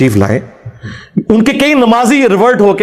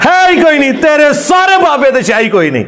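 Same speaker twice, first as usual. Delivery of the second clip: triumphant shout; 7 semitones higher than usual. Real, not acted. Boasting of the Prophet over rivals' saints, laughing shout.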